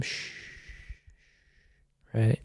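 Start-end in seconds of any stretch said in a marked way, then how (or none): 0.0-0.3 a quiet hushing sound, barely voiced; soft shush. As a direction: shush him gently like a sick man. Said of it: "She shushes him".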